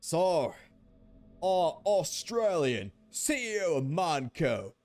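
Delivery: hammed-up Australian accent, loud and swooping; big, high-pitched Australian accent. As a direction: play it as a broad mock Australian. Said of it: "say it in an Australian accent".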